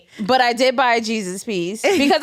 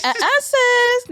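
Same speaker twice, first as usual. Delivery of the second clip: sassy refusal; 13 semitones higher, slower than usual. Retold or imitated. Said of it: "Bank of America done like".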